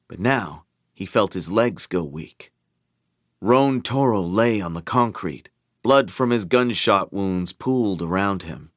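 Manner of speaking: usual speaking voice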